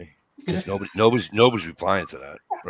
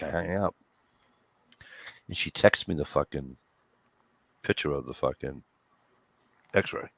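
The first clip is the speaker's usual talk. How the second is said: ordinary talk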